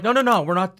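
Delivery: mock denial. Party denies being corporate spies, mock hasty. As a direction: brush it off in a rush. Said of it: "they're like".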